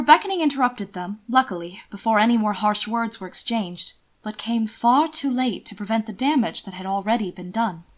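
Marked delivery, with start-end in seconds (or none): none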